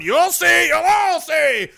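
shouted high and loud; wild unhinged ranting. Acted. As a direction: yell it like a madman.

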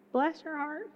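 "Bless" rising in mock sweetness; sugar-coated put-down; Southern sarcasm, softly sweet; sarcastic bless your heart. Shown as a demonstration. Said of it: "it's not really nice".

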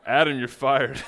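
loud joking "boss" shout; mock firing. Pastor jokingly firing a helper mid-sermon, loud mock shout. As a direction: call it out in pretend outrage.

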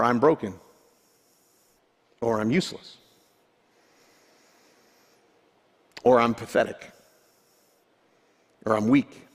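low, subdued, mildly acted despair; dejected self-talk. Voicing ruminated negative self-talk.

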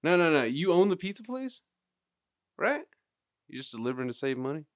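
high-pitched incredulous questioning; mock disbelief. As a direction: put on a high, baffled voice, mock astonished. Acted astonishment at delivery driver.

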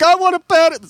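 high loud put-on voice. Anxious guy asks the host's girlfriend in the put-on voice. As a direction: squeal it like an excited kid. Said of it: "talking in the voice".

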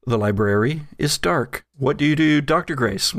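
ordinary delivery

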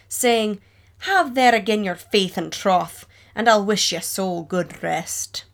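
old Scottish ballad recited in an attempted Scots accent; lilting, dramatic Scottish-accented verse recital; poor Scottish accent. Margaret's ghost-lover ballad recited to listeners, in a Scottish accent. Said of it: "excuse my poor Scottish accent".

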